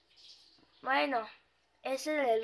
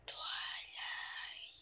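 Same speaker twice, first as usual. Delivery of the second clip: low, eerie ghost whisper; 13 dB quieter than usual. Acted. The ghost calls the pony by name in an eerie whisper. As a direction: breathe the name like a haunting spirit.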